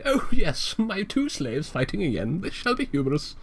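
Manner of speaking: high haughty aristocrat voice, fading down; pompous George Washington. Retold or imitated. Mocking George Washington as a haughty aristocrat. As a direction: play a smug old-world gentleman. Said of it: "George Washington ... He was like".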